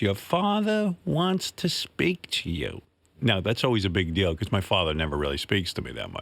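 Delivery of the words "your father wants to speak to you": slow, higher, a touch softer, like announcing a summons; mildly mock-solemn mom, slow and high; mom's solemn announcement. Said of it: "and then she goes".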